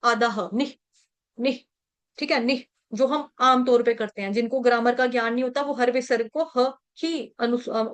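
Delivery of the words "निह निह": निह with short i and a puff of h; visarga as half h. Shown as a demonstration. Teacher demonstrating the visarga, निह ending in half h. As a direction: end निह on a half ह, no vowel. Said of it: "उसका उच्चारण आधा ह होगा".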